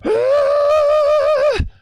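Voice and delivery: long, very high, loud squealing gasp; freaking-out gasp. Retold or imitated. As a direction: gasp like you are freaking out with amazement. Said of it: "freaking out".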